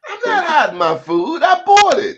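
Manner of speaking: indignant defence. Retold or imitated. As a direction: defend your food loudly. Voicing the stingy host.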